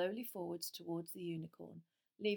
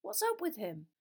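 put-on pressing boy; 11 semitones higher, 5 dB louder than usual. A narrator's reading. Harry pressing.

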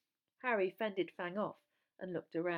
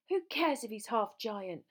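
raised, indignant shout; louder, higher angry outburst; 4 semitones higher, 6 dB louder than usual. A narrator's reading. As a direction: shout it back in anger. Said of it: "Harry shouted".

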